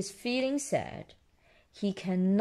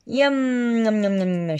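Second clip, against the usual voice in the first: loud, sing-song munching yums; playful storybook tasting noise; relishing; 5 dB louder than usual. A narrator's reading. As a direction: savour it in a run of yums. Peppa tastes an apple.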